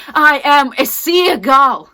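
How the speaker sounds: loud, high, drawn-out wail; anguished cry. Acted. Actress naming herself a seagull, anguished drawn-out shout. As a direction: cry it out slowly at full force.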